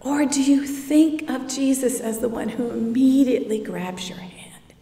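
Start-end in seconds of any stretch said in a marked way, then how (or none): 3.6-4.7 hushed, dropping to a whisper. Real, not acted. Preacher hushing as Jesus grabs your hand, whispered. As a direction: breathe the rescue softly. Tender image of rescue.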